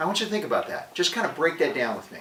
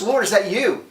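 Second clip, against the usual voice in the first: lightly acted call, higher and louder; Peter calling out; 7 semitones higher, 6 dB louder than usual. Peter to Jesus coming across the water, lightly acted calling out. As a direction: call out a startled question, half in earnest. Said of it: "Peter's the only one that actually realizes".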